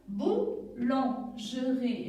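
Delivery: "boulangerie" slow, in four separate beats; syllable by syllable. Shown as a demonstration. Teacher showing four syllables, "boulangerie" slowly split. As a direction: beat out each syllable of "boulangerie". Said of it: "quand il y a quatre syllabes".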